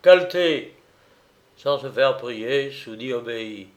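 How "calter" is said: short, high, loud cry; raised single-word yell; ordered shout. A narrator's reading. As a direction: call it out on command.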